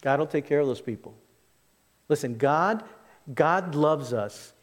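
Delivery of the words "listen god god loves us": ordinary delivery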